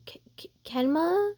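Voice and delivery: hesitant stammer rising high; startled stammer. Acted. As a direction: stammer the name in rising disbelief. Startled waking beside him.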